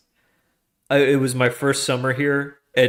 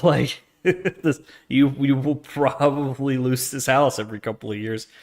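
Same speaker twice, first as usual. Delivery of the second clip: usual speaking voice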